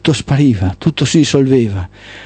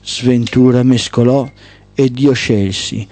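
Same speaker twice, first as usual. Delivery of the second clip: ordinary delivery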